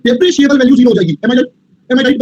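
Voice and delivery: usual speaking voice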